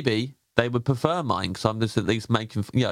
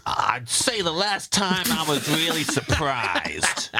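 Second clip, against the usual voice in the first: slow, raised American voice; American accent; 6 semitones higher, much slower than usual. Shown as a demonstration. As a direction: stretch it out in a put-on American accent. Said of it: "in my American voice".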